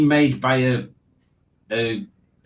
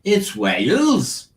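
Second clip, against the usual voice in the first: higher, slow swooping Welsh lilt; Welsh accent; 6 semitones higher, slower than usual. Acted. Quiz host repeating the joke answer, exaggerated Welsh accent. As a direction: swoop up high and drag it out in Welsh.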